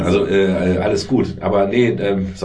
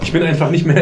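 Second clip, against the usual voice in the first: usual speaking voice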